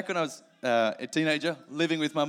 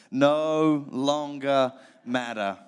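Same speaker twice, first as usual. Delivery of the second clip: No longer matter, each word slow and leaned on; slow emphatic unison; 5 dB louder, much slower than usual. Shown as a demonstration. Preacher leads congregation to repeat the phrase, slow and emphatic. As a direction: lead the congregation, say it slowly together. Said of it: "Could you say that with me?".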